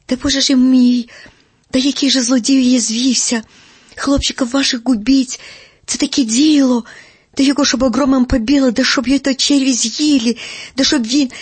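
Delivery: loud indignant curses. Acted. Uncle Andrey curses the unknown goat thief, loud and indignant. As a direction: heap curses on the thief at full voice.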